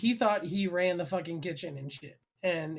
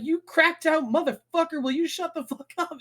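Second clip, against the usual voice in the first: higher mock yell at the cook; retold yelling; 9 semitones higher than usual. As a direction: go off on him. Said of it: "I'm like; I just go off".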